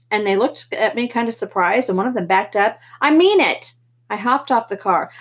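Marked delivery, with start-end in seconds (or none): 3.0-3.7 sharp, loud warning; mean every word; firm threat. Acted. Threatening socs with bottle.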